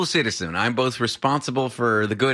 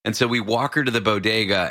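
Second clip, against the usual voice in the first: ordinary talk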